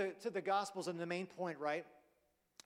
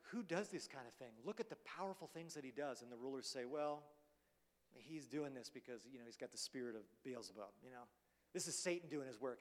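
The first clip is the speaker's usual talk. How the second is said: softer and lower, scheming aside; muttered accusation; 5 semitones lower, 8 dB quieter than usual. Retold or imitated. Rulers accusing Jesus of Beelzebub, low soft mutter. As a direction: mumble it like a jealous insider.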